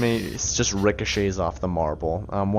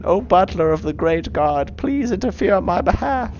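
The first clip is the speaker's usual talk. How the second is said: grand supplication rising higher at the end; pleading worshipper; 8 semitones higher, 4 dB louder than usual. Acted. Worshipper appealing to the god's butler, pleading. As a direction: beg formally for someone to intercede.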